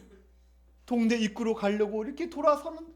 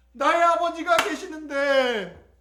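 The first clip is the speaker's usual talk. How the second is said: higher, slow, drawn-out longing; swelling longing; 4 semitones higher, slower than usual. Acted. Prodigal son realises he has a father, higher and fervent. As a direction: let the longing swell, raised and stretched. Son repeats it with rising feeling.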